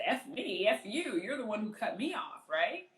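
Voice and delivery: indignant retort. Retold or imitated. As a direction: throw the blame back, indignantly. Acted retort in traffic quarrel.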